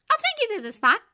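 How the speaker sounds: put-on high, bright girl's voice; bubbly young woman; cheerful young woman voice. A narrator's reading.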